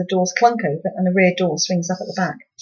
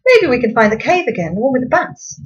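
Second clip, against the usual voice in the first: higher, eager mother's voice; bright Mum voice; 5 semitones higher, 5 dB louder than usual. A narrator's reading. Mother to family in the car, a bright eager lilt. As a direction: Mum cheerily suggesting the bat cave. Mum's hopeful suggestion.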